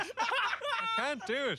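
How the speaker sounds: high laughing squeak. Real, not acted. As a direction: break into laughter mid-line. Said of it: "Your voice thing".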